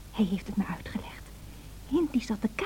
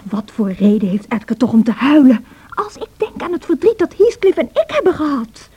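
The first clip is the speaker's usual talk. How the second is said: louder, rising with feeling; passionate outburst; 7 dB louder than usual. Acted. Cassie to Nelly, defending her love for Heathcliff: passionate outburst. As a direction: pour out the old grief.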